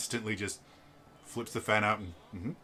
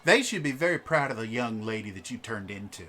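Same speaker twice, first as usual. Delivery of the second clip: louder, fond praise; doting noblewoman; 5 semitones higher, 8 dB louder than usual. Acted. A doting wife praising a polite girl, a touch louder. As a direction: praise the girl's manners.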